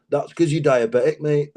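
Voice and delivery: a hint of a blunt gym mate; deadpan friend's reply; mate in the sauna. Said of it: "his mate who turns around and he goes".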